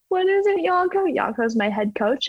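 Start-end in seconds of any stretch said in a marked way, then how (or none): 0.1-1.1 imitating herself. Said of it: "And I'm like".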